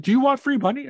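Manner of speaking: high, loud mock Jamaican caller; heavy Jamaican accent. Caller offering free money, heavy Jamaican accent. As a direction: imitate a pushy Jamaican telemarketer. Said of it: "Very heavy Jamaican accent".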